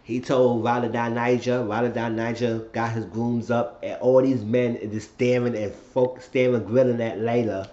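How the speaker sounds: ordinary talk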